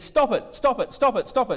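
loud, high shouts, each falling sharply; repeated mock yelling. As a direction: yell it over and over at the client. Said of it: "he keeps yelling at her".

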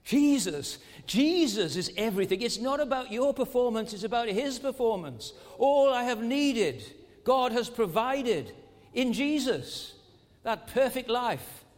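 the refrain thundered from the pulpit; preaching climax. Acted. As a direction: build the refrain to its peak. Refrain repeated at full force.

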